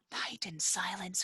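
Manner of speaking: soft, startled hush; hushed gasp. Acted. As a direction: gasp then hush the words. Gasps, hushed as Robin.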